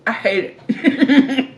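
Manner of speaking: laughing. Real, not acted. Laughing at the word moist.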